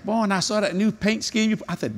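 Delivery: ordinary delivery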